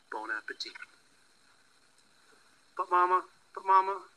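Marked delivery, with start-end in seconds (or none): 2.8-4.0 put-on higher and louder eager child. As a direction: beg Mama like an excited kid. Child's pleading call, louder, higher.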